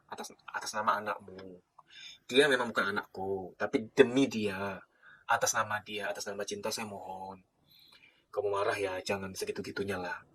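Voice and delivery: low, soft, slow heartfelt plea; soft pleading. Real, not acted. Speaker begging parents on behalf of hurt children, soft and pleading. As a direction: beg them gently, almost under the breath.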